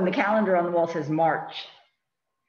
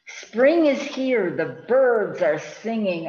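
put-on reading to children; 3 semitones higher, 6 dB louder, much slower than usual. A narrator's reading. Reading book aloud.

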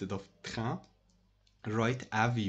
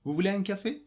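Vous voulez un café asked higher, with voulez rising; offering question; 8 semitones higher, much faster than usual. Shown as a demonstration. Teacher to class, offering coffee in a high rising question. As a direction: lift it like an offer. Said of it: "it's an offer".

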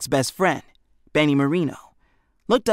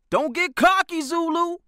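much higher character voice for the taunting pitcher; a boy pitcher taunting the batter, in a much higher voice; bully pitcher voice. Acted. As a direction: needle the batter from the mound, pitched well up. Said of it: "Hefty said".

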